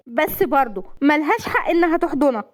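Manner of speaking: high, petulant objection; jealous protest. Acted. Jealous wife objecting to the hug, high and petulant. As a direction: complain in a high, sulky voice.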